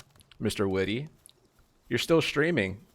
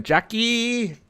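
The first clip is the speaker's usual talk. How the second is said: Jackie! swooped up very high and loud, falling at the end; sing-song name call; 7 dB louder than usual. Real, not acted. Streamer gleefully calls out a viewer's name, high and loud. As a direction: call out Jackie! like a happy squeal. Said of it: "I can't help but say your name like that".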